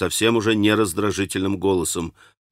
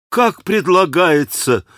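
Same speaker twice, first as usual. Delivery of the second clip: higher and slow, aghast; shocked exclamation; 7 semitones higher, 3 dB louder, slower than usual. A narrator's reading. Потрясённо воскликнуть, выше и медленно. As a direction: Alyosha shocked at his brother's words, crying out. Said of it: "воскликнул Алёша".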